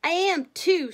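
put-on high, loud little boy's retort; child's defiant yell; angry shout. A narrator's reading. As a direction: shout back indignantly, pitch jumping up. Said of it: "shouted Freddie, now becoming very angry".

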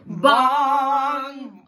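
drawn-out loud bong; one big booming bong; bell bong. Shown as a demonstration. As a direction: ring it out like a great bell. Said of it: "Can you make that sound with me".

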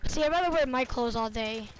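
normal talking voice

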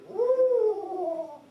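loud owl caterwaul sucked in; barred owl cackle. Shown as a demonstration. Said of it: "you do this at the end".

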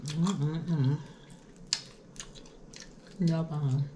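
talking while chewing food; muffled hum through closed lips; mouth full, chewing. Real, not acted. Seen 0: Bites wing before, lips closed and moving while holding chicken.

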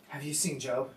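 low, soft and proud, as God asking about Job; hushed God voice. Retold or imitated. God asking the heavenly court, hushed and low. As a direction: say it softly, proud of him.

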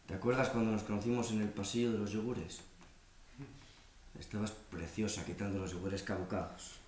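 low, very soft intimate voice; tender reminiscence. Acted. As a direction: recall the first meeting softly, lingering. Intimate memory of first meeting.

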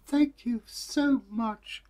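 put-on high, slow little girl thanks; sweetly grateful child. Girl thanks neighbour in a high slow voice.

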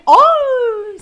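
high, loud "Aa"; astonished gasp. Pretend. Officer finding smuggled cigarettes, astonished cry. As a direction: cry out at a sudden find.

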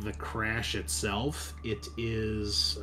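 ordinary talk, nothing marked

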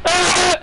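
frustrated yelp. Real, not acted. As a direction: let out a sharp groan. Frustrated game moment.

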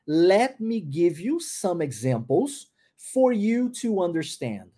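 "Let me give you some examples for you to understand" said very slowly, rising then falling; slow careful model. Shown as a demonstration. Teacher slows the sentence so students hear the linking. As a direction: linger on every linked word, rise on "you", fall at the end. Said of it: "olha a pronúncia disso".

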